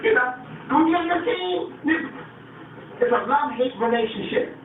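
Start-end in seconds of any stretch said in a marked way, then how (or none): 0.7-1.6 high, wheedling imitation of a beggar; mock begging. Outsiders pleading to join the team, high mock begging. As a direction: beg to be let in, pitched way up. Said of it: "They will come begging".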